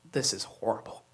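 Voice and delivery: low deadpan groan of dismay; mock horrified mutter. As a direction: mutter it under your breath in dismay. Inner reaction muttered.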